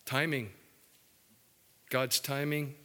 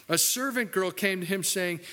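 usual speaking voice

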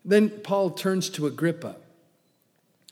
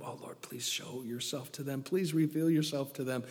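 much softer, hushed plea; quiet prayer; 2 semitones lower, 9 dB quieter than usual. Acted. Preacher praying over someone reading scripture, hushed. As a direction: pray under your breath.

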